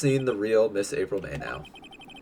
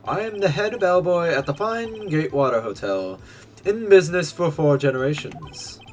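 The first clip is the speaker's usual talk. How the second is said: higher, eager hotel bellboy; polite bellboy voice; 7 semitones higher, 4 dB louder than usual. Acted. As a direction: present it like a proud servant.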